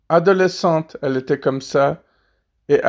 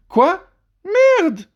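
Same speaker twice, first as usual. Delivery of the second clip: put-on high baby voice; 13 semitones higher than usual. Baby talking.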